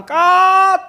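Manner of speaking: high loud barked command; mock angry God. God stopping the scene, high loud mock order. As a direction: call cut like an angry director.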